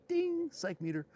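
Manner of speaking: high, drawn-out ding, then the words; mimicked catchphrase with a high-pitched ding; Jamie O'Brien catchphrase. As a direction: sing out a ringing ding like a meter going off. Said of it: "as Jamie O'Brien would say".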